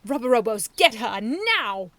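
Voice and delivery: loud, slow barked command; angry order. A narrator's reading. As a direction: order them to attack. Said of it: "he ordered".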